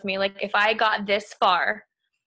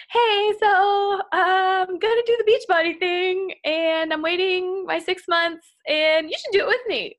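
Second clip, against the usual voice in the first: friend's pitch; 9 semitones higher than usual. Retold or imitated. Best friend urging her to join Beachbody, in a higher eager imitation.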